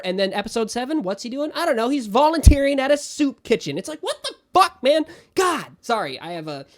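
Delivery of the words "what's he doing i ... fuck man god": much higher, louder exasperated rant; real ranting fury. Real, not acted. Fan ranting at a show that wastes episodes, loud and exasperated. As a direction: vent like you're personally betrayed. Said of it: "I'm very impassioned about how mad that makes me".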